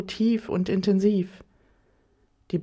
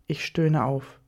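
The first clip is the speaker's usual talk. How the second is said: plain reading voice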